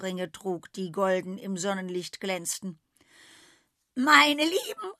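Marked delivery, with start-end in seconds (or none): 3.9-4.9 high, loud shrill cry; shrill call. A narrator's reading. Goat greets the animals with a shrill call. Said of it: "rief sie schrill aus".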